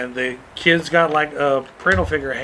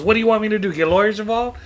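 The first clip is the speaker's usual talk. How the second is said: higher-pitched scornful retort; mock defiant challenge; 5 semitones higher, faster than usual. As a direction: mimic a dismissive comeback, pitched up. Repeats the defiant parent's retort.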